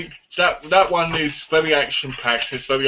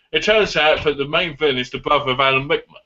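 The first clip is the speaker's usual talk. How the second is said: ordinary delivery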